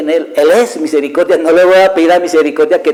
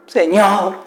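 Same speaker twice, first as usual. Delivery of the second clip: mock tearful cry, pitched higher; imitated tearful plea; 5 semitones higher than usual. Sinners calling on their judge, imitated tearful plea. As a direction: call out as if begging in tears. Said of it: "yo lo dije muchas veces llorando".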